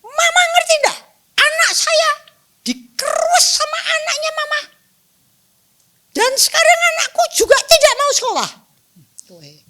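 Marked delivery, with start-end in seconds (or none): 0.0-8.5 very high, loud acted shouting; losing patience. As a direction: hurl the accusation back at the other mother. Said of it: "lama-lama kehilangan kesabaran juga". (hilang kesabaran, berteriak marah)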